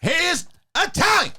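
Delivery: Pacino rant. Acted. Pacino rant bit continues.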